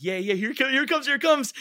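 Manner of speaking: high, loud, mounting excitement; eager anticipation. Retold or imitated. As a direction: act out waiting for your big moment, giddy. Acting out eager wait watching demo.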